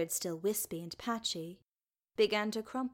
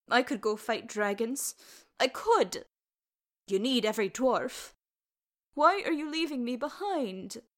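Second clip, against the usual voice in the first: put-on louder, insistent boyish pleading; young lad's protest, climbing higher; indignant boy; 4 semitones higher, 5 dB louder than usual. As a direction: argue hard like a child left behind.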